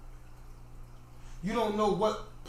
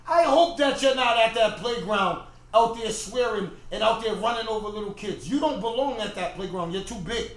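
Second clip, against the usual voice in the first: raised-voice telling-off; mock lecture, louder at the start; acted parental scolding; 3 semitones higher, 6 dB louder than usual. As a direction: scold a big kid as a parent would. Acts out parent scolding child.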